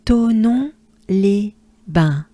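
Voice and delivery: Tonnons les bains, slow and carefully spaced; slow French drill. Shown as a demonstration. Teacher slowly modelling the full place name. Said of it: "we take a good breath".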